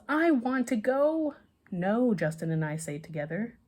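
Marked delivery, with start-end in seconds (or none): 0.1-1.3 put-on slow, much higher little-boy yell; whiny yell. A narrator's reading. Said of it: "Danny yells". 1.7-2.1 firm no. A narrator's reading. Both refuse together.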